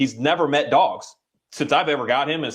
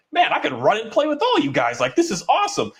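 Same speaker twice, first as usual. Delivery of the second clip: much higher, thrilled playful voice; excited dog voice; 13 semitones higher than usual. Retold or imitated. Trainer voicing his shepherd Kahi thrilled to play with other dogs, much higher and excited. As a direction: let the dog gush with joy.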